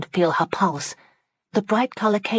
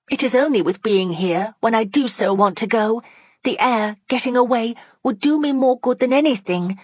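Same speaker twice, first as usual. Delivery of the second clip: higher, earnest plea; pleading; 7 semitones higher, 4 dB louder than usual. A narrator's reading. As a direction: beg to be let out into the air. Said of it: "pleaded she".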